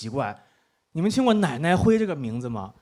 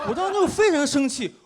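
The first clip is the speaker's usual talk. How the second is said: ordinary talk, nothing marked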